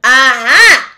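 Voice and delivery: very loud, high triumphant cry; triumphant shout. Acted. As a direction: pounce on the word. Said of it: "interrupted Officer Shrift".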